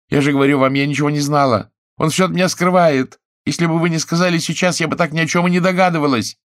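high, loud offended cry; indignant exclaim. A narrator's reading. Chiara snaps at the sergeant, high and loud. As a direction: snap at the deaf policeman, loud and high.

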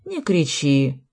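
mildly stern, restrained order; a touch of cold severity. A narrator's reading. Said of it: "строго сказал мужчина в штатском". (сдержанно и строго)

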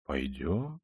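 very soft, low, idle reply; quiet, lazy. A narrator's reading. Raskolnikov answering the townsman's threat, quiet and lazy. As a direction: let it out softly and lazily. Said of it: "сказал так же тихо и лениво".

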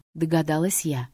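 ordinary delivery, nothing marked

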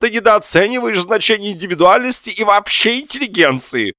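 high, loud declaiming wail; wounded high-pitched self-importance; pompous husband. A narrator's reading. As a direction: lecture her in theatrical indignation. Husband pompously lectures wife.

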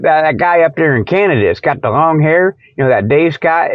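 ordinary delivery

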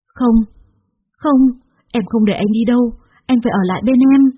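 high loud cries of no; desperate cry. A narrator's reading. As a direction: refuse to let him go. Woman begging him to stay. (người phụ nữ gào lên níu kéo)

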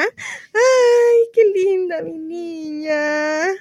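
high, slow, weeping tenderness; tearful coo. Acted. Mother weeping over her little girl, tearful. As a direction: sob it out, overcome with love.

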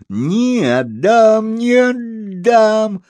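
очень высокий протяжный детский вой; реви капризно, растягивая каждое слово; капризный рёв. A narrator's reading. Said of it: "Вдруг заревела она". (истошно-капризный высокий рёв девочки)

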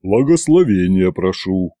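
deep, humble request; low-pitched, respectful plea; Ivan's bass. A narrator's reading. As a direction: ask a blessing in a very low voice. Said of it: "поклонился ей Иван".

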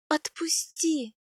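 high, slow, strained plea; choked plea. A narrator's reading. Wife begging husband to let go of her neck, choked. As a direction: beg release through a squeezed throat. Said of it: "прохрипела я".

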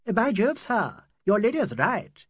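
high, slow, loud character voice; echoing Eurasian chauffeur. A narrator's reading. Eurasian chauffeur echoing his English master, eagerly agreeing. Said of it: "echoed the Eurasian".

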